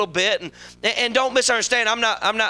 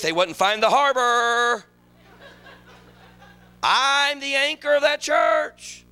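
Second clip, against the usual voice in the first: mock self-pity; 6 semitones lower than usual. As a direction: whine like a self-pitying believer. Preacher mocks self-pitying prophet.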